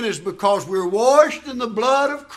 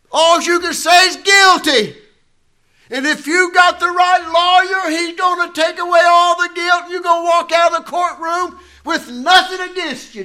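preaching at full force; 9 semitones higher, 5 dB louder than usual. Acted. Preacher to his congregation on Christ pleading for us, full-force shouted preaching. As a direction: lift the church with a ringing shout.

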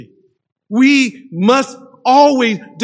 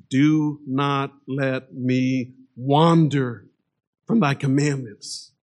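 much softer, very slow and lower plea; soft crying out; 5 semitones lower, 5 dB quieter, slower than usual. Retold or imitated. Psalmist pleading with God, soft slow plea. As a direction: let the words sink into a hushed, slow plea to God. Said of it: "He then cries out to God".

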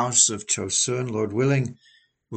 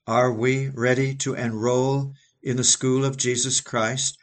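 normal talking voice

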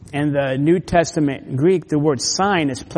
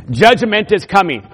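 higher, forceful preacher's warning; loud proclamation; 5 semitones higher, 8 dB louder than usual. Acted. Voicing a warning to the church.

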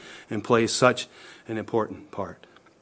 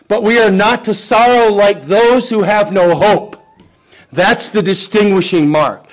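raised, louder pulpit voice pressing the point; fervent preaching; 6 semitones higher, 7 dB louder than usual. Acted. Preacher urging the church not to despair, fervent preaching. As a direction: preach it out, building conviction. Preaching hope over sorrow.

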